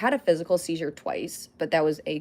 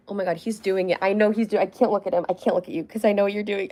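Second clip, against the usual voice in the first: normal talking voice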